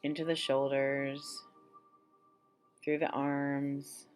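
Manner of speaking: calm guided-meditation voice with long pauses. Acted. Leading listeners through an abundance meditation, unhurried meditation voice. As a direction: guide listeners gently into relaxation, phrase by phrase.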